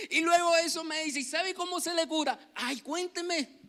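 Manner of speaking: high, excited storytelling; animated retelling. Retold or imitated. Pastor retelling the mother's joke cure, high and animated.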